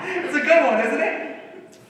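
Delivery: laughing through the words; chuckling, high and loud; pleased with his own gag, laughing. Real, not acted.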